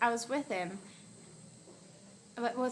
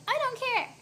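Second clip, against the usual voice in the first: much higher, squeaky imitation of a friend; cute little voice; 12 semitones higher, 5 dB louder than usual. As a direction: mimic her cute voice way up high. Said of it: "The little voice like that".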